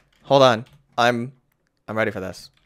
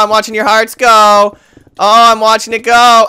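ordinary talk

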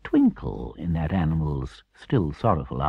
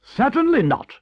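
very high, loud refusal; squeaky, emphatic denial; 7 dB louder than usual. A narrator's reading. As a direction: refuse outright as indignant Toad. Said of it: "replied Toad emphatically".

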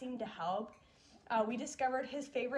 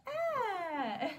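put-on high, changed-pitch baby "Ah"; baby pitch change; 8 semitones higher than usual. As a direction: copy the baby's new high sound. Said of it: "change his pitch of voice".

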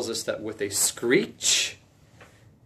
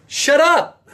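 Jesus shouting a sharp command, high and loud; 11 dB louder than usual. Acted. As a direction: bark it out like a command to a demon. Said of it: "What Jesus actually literally said was".

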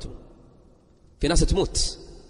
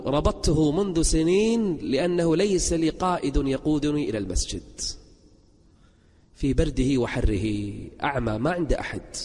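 put-on solemn blind old man; 2 semitones lower, slower than usual. Blind old man explaining his rope to visitors, solemn slow answer. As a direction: draw out the old man's words with gravity.

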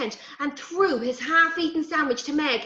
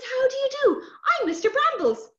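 high, polite little sprite; the hedge sprite introduces himself to the children, high; Mister Brambles; 12 semitones higher, 4 dB louder than usual. Acted. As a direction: tip your hat and chirp a greeting. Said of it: "lifted his hat from his head and says".

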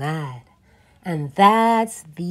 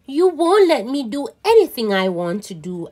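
fast complaint; 4 semitones higher, faster than usual. Acted. Child complains to Mom.

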